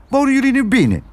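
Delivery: hoge kraaienstem; kraai Kwark. Acted. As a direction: praat hoog, als een pratende kraai. Kraai Kwark spreekt verbaasd. (hoog kraaienstemmetje)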